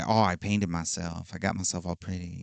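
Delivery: campy quote. Shown as a demonstration.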